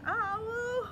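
loud, high whooping imitation of a conch horn; loud conch blow. Blowing the conch shell.

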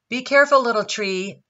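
high, loud sharp warning; very angry voice. A narrator's reading. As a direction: scold the little tree angrily. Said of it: "in a very angry voice".

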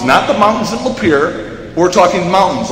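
normal talking voice

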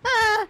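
sharp high scream; startled scream. Real, not acted. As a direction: cry out when hit. Killer hits her.

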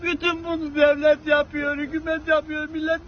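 ordinary delivery

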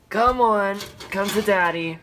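creepy loud come-hither call. Acted. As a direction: lure them in, creepily. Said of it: "That was so creepy".

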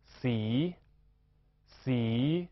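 洗 held long, dipping then rising; drawn-out rising syllable. Shown as a demonstration. Teacher models the word for colour, 洗 long and rising. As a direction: stretch the vowel of 洗 and lift its end. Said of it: "我们读作".

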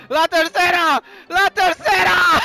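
high, loud triumphant shout; elated repeated shout; excited goal call. Real, not acted. Celebrating third goal.

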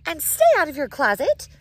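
loud, sharp final order; loud parting order. Acted. Mother barking a last order before shutting the door, loud. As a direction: fire it off as the door closes. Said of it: "she added".

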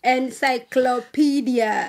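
"Encyclopedia" slowly, part by part; careful dictation. Real, not acted. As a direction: give "Encyclopedia" out slowly for a spelling test. Repeats the word for the contestant.

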